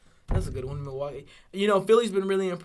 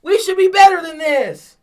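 loud, high, slow shout; frustrated fan yelling at the screen; furious shout; 10 semitones higher, 13 dB louder, slower than usual. Real, not acted. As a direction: bellow it in frustration. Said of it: "You're gonna break something".

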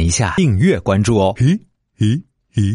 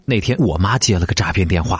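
ordinary talk

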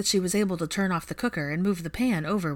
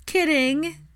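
cackling; 11 semitones higher, 5 dB louder than usual. Acted. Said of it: "Ginny practically cackled".

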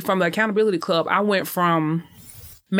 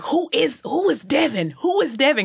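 much higher, indignant demanding; upset boyfriend; 8 semitones higher than usual. Retold or imitated. Cousin's boyfriend upset over the book, high indignant demand. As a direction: demand to know who he is, riled up. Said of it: "and he was upset".

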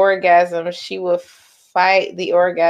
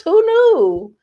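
high, loud who-knew; mock amazement; 12 semitones higher, 5 dB louder than usual. Real, not acted. Tears as an ego boost, mock amazement. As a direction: pitch it way up in mock wonder.

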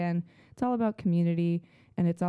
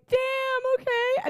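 high-pitched squeaky damn okay; high mock surprise; much slower than usual. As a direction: squeak it out, stunned and hurt. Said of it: "Matthew and Owen were like".

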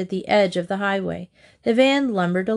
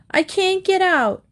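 put-on high little child's voice from inside; a lightly voiced small boy, muffled and stuck; trapped little boy's small voice; 11 semitones higher, 3 dB louder than usual. A narrator's reading. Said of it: "a small voice said".

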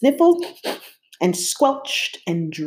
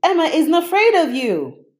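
much higher, louder cheer; 12 semitones higher, 5 dB louder than usual. A narrator's reading. Narrator cheering on his monster. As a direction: call out in triumph. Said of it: "I cheered".

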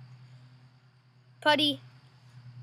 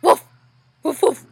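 dog bark imitation; 4 dB louder than usual. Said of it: "Putty, speak".

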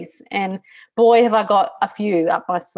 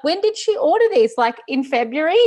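amused disbelief; 8 semitones higher, 5 dB louder than usual. Retold or imitated. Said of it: "I said to Mattia".

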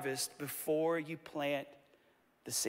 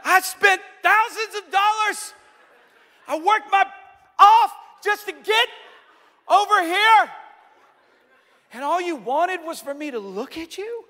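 outraged husband; 11 semitones higher, 8 dB louder than usual. Retold or imitated. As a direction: act out his outraged complaint. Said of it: "I'm like".

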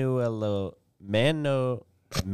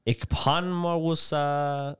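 high, very slow mock African accent; 5 semitones higher, much slower than usual. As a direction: stretch the name out in a put-on African accent. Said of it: "that's so racist, what I just did".

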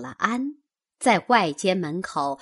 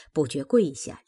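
ordinary delivery

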